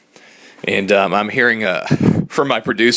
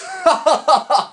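high breathy giggle; high laugh. Real, not acted. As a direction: giggle at himself. Laugh after dressing.

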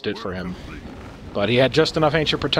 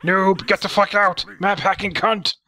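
high-pitched indignant chat rant; mock angry gamer; 7 semitones higher than usual. Commentator voicing a player's chat insults, mock anger. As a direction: read it like a fuming noob-caller.

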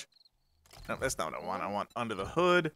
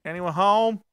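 higher-pitched call into a house; playful calling out; 7 semitones higher, 4 dB louder, slower than usual. Pretend. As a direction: call out to see who's in. Calls into a house in play.

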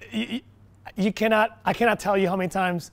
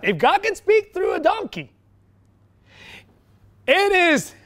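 much higher, incredulous-sounding emphasis; emphatic exclamation; 11 semitones higher, 4 dB louder than usual. Real, not acted. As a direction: drive the point home at a high pitch. Repeats point in raised emphatic voice.

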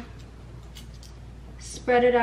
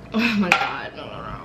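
gasping from spicy food; strained through the burn; mouth on fire; 6 semitones lower, 4 dB quieter than usual. Real, not acted. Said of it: "so spicy".

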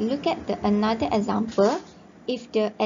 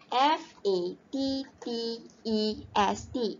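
slow spelling. Shown as a demonstration. Teacher spelling fattest for students, slow and deliberate. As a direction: spell each letter slowly and clearly. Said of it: "teacher spells out the word".

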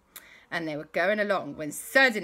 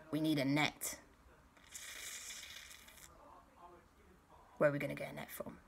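hushed plotting; 3 semitones lower, 12 dB quieter, much faster than usual. Acted. Sneaking up on the dinosaur.

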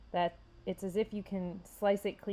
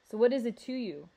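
raised, louder retort; upset; 4 semitones higher, 6 dB louder than usual. A narrator's reading. Rhino challenges the kid who stepped in, upset. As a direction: challenge them, mildly upset.

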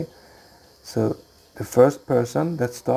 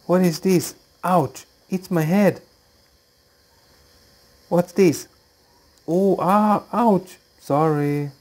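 put-on higher, louder startled girl voice; acted Lucy in the dark; 6 semitones higher, 4 dB louder than usual.

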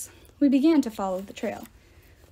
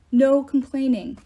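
louder, slow order, stress on No; stern louder command; 2 semitones higher, 7 dB louder, slower than usual. Acted. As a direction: bark the rule down firmly. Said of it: "Quintus ordered".

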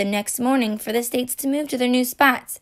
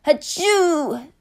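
mock sneeze; 5 semitones higher than usual. Texas sneezes.

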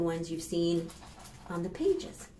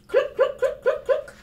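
high, loud clucking with a laugh; hen clucking; 6 dB louder than usual. Acted.

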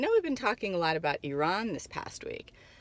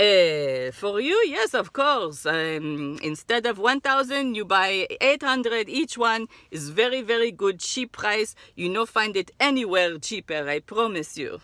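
put-on Israeli accent; 5 dB louder than usual. Seller offering discount.

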